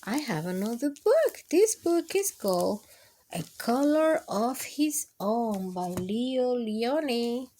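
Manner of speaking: ordinary delivery, nothing marked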